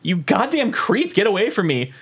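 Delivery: high, drawn-out indignant protest; hammed-up outraged woman, pitched high; mock outrage. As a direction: act out being walked in on, appalled. Acts out startled, outraged woman in stall.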